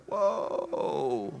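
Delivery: low, soft, drawn-out whoa; taken aback. Acted. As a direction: breathe it out in wary amazement. The world recoils, taken aback.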